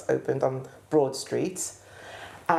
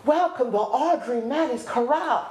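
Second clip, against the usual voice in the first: a hint of a showman introducing an act, higher; stage announcer; 8 semitones higher than usual. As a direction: present her to the crowd with flair. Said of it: "And he says".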